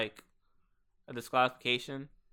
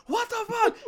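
retold high-pitched freak-out squeal. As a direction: squeak it in mock panic, way up high. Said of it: "mind you, I'm freaking out".

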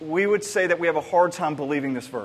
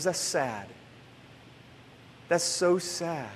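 put-on quiet child sadness; 2 semitones lower, 8 dB quieter, slower than usual. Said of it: "got really quiet".

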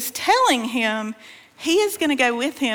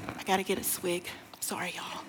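near-whispered, much softer and lower; hushed aside; 5 semitones lower, 13 dB quieter, faster than usual. Real, not acted. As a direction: drop to a whisper for a quick apology. Aside before taking a drink.

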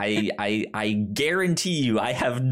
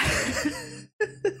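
high breathy burst of giggling; laughing at the joke; high giggle; 3 dB quieter than usual. Real, not acted. Laughing at average ears.

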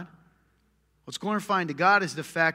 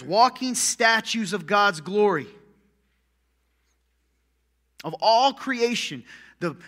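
loud, higher, driving sermon voice; fiery preaching; 6 semitones higher, 7 dB louder than usual. Acted. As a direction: preach it hard to the congregation. Preaching at full force.

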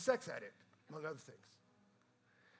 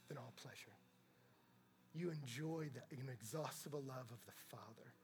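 whisper; 3 semitones lower, 5 dB quieter than usual. Acted. Quoting Augustine's prayer softly.

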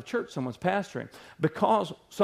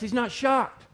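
ordinary delivery

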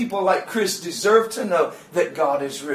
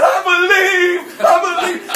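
imitated tearful outcry; 9 semitones higher, 4 dB louder than usual. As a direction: cry out as if overwhelmed, retold. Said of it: "he started shaking and crying".

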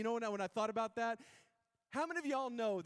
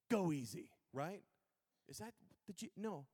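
usual speaking voice

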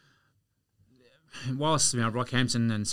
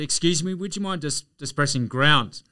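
higher, louder and insistent; a bit louder; 6 semitones higher, 7 dB louder than usual. Retold or imitated. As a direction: raise your voice so the stranger hears. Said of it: "so I said it a bit louder".

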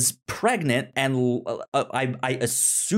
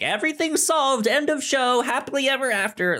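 higher sing-song mock fairy tale ending; sarcastic; 14 semitones higher than usual. Real, not acted. Host mocking the idea of a neat happy ending, higher sing-song. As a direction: lilt it up like a storybook ending.

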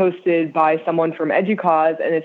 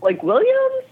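high puzzled question. Retold or imitated. Retold own clueless reply.

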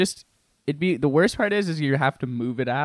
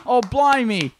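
higher, louder mock English dismay; English accent; 8 semitones higher, 6 dB louder, slower than usual. Reacting to the McDonald's robbery, hammed-up English accent. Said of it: "That's how it went in England".

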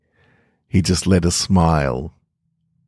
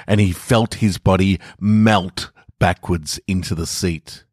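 ordinary delivery, nothing marked

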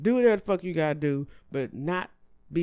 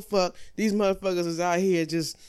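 usual speaking voice

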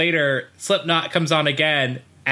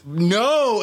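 imitated crowd refusal; 5 semitones higher than usual. Retold crowd refusing an encore.

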